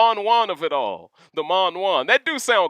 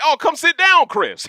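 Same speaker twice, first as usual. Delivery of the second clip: high, louder call to come sit back down; 10 semitones higher, 6 dB louder than usual. Retold or imitated. Host calling Chris Kattan back to his seat, raised call. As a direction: wave him back over with a raised voice.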